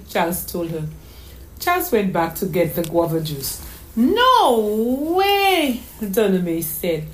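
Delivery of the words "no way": high, loud, stretched exclamation; wide-eyed, squealed amazement; astonished little girl. Acted. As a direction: draw it out in child's disbelief. Child astonished by uncle's cooking.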